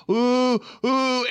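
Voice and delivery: high, loud "ooh" moans; mock pleasure moans. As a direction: moan it in hammed-up rising excitement. Acting out pleasure in sex analogy.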